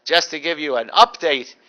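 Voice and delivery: ordinary talk, nothing marked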